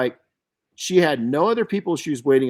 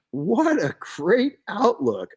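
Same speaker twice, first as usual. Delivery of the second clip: impressed; 6 semitones higher, much slower than usual. Retold or imitated. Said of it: "And I was like".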